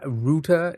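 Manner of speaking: router as "rooter"; proper pronunciation. Retold or imitated. Streamer approving the Canadian way, router as "rooter". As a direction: say router with an "oo" vowel. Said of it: "you say it properly then".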